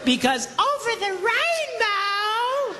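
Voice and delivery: high, slow, dreamy mock sigh of a wife. Pastor mocking wives wishing husbands would just know, dreamy high mock voice. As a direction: sing it out like a lovesick wife, slowly.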